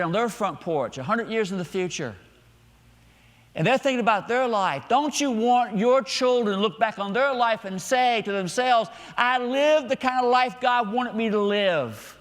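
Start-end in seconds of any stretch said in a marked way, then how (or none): 3.5-12.1 high, loud, slow preacher's declaration; full-force preaching. Real, not acted. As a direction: drive each phrase home to the congregation, slowly.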